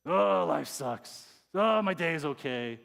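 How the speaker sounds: drawn-out, groaning complaint; mock grumble about life; grumbling. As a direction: grumble it out with a long, loud "Oh". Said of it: "it's when you're grumbling".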